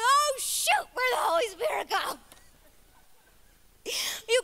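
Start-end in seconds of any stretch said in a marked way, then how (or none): 0.0-2.2 high loud alarmed shout; panicked surprise. Acted. Believer realizing the Holy Spirit left, shouted. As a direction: yelp in mock panic. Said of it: "You're like".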